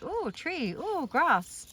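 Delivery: high, soft oohing; animals' wonder, lightly voiced. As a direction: lightly imitate curious animals marvelling. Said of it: "they're like".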